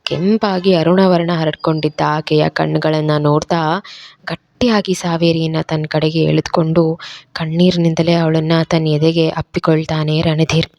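plain reading voice

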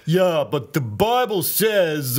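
put-on New York accent. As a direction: give it a New York twang. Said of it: "uh, New York".

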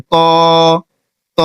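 ত held for one beat; short Arabic letter. Shown as a demonstration. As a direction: say ত with a short pull. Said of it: "ত বলতে হবে, এক আলিফ টানতে হবে". (ত এক আলিফ টেনে)

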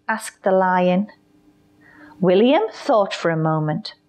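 plain reading voice

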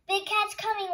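high, loud frightened wail. A narrator's reading. Henry hiding his eyes from Big Cat, wailing. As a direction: cry out the alarm. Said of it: "wailed Henry".

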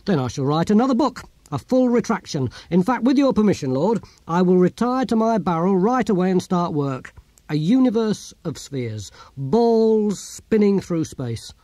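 put-on humbly pleading old philosopher. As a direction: plead your case fervently to a lord.

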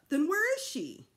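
loud, high urgent demand; panicked mother demanding to know; alarmed. A narrator's reading. Mother realizing baby is missing, alarmed.

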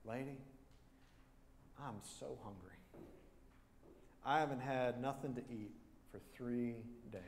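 hushed, pleading hunger; quietly. Retold or imitated. Hungry man quietly pleading with the old lady. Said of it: "and he said quietly".

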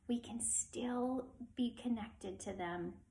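soft unvoiced whisper; teacher whispering to her class. Real, not acted. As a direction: whisper it like a comforting secret. Teacher softly tells children.

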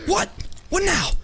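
short high-pitched bursts; triumphant cries. Real, not acted. Gamer finishing off a downed opponent, triumphant cries. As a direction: crow over each blow.

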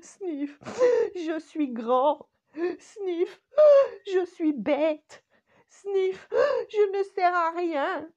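high, loud, slow sniffling wail; sobbing. Acted. The sad middle finger sobs about being useless. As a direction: sob out each word like a crying child.

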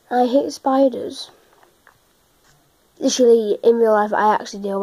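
ordinary talk, nothing marked

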